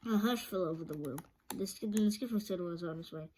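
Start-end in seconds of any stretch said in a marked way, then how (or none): none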